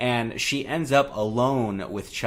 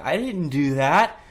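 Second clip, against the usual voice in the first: put-on higher, slow pleading kid voice; child's protest; 5 semitones higher, 5 dB louder, slower than usual. Andy protests to the teacher, high slow kid protest. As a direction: plead like an accused boy. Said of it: "he's like".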